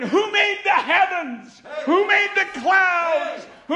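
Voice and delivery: very loud, high, slow shouted preaching; fiery preaching. Retold or imitated. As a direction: thunder it out like the Spirit just fell on you. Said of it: "I just started preaching".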